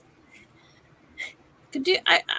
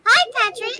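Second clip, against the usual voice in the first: high, loud squeaky SpongeBob try; SpongeBob attempt; 10 semitones higher, 5 dB louder than usual. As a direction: chirp it like an eager sponge. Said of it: "I can't really do SpongeBob".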